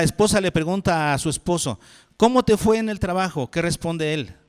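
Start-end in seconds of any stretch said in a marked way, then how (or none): 2.2-3.5 higher, louder lightly voiced wife; bright curious wife greeting her husband; wife asking her husband about his day, lightly voiced higher; wife's question.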